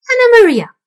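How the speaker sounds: high, loud calling shout; loud rat call. A narrator's reading. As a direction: call her loudly up high. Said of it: "said the old man rat".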